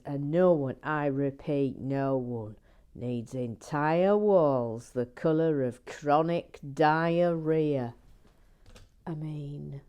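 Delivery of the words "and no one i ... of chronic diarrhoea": louder, slow pompous decree; imitating Gary Blair. Quoting Gary Blair's rant about wall colour. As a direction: declaim it like an outraged man. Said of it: "he also says".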